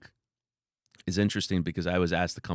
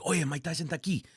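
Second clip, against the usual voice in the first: excited bystanders; 9 semitones higher, 6 dB quieter, faster than usual. Retold or imitated. Bystanders quoted.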